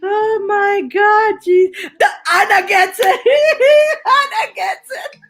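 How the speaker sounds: high, loud, laughing till tearful; real hysterical laughter. Real, not acted. Delighted that viewer Anna gets it, hysterical high laughing. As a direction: squeal the words through helpless laughter. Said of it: "I'm laughing".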